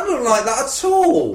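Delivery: lightly put-on comic character voice; a half-done comic character, slightly lifted in pitch; Mister Chumbonga. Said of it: "That wasn't quite Mister Chumbonga".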